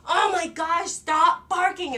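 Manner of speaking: loud, high, slow furious shout; mad yell. Retold or imitated. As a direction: drag out the scolding, fed up with the barking. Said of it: "And I was so mad".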